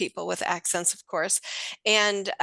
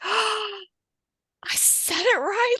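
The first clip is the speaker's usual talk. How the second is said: high, slow, awestruck squeal; shocked delight; 13 semitones higher, much slower than usual. Retold or imitated. Trainer imitating nurse who finally said it right, shocked. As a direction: stretch it out high, imitating stunned amazement. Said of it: "biggest shock ever to her".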